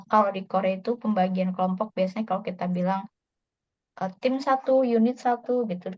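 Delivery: normal talking voice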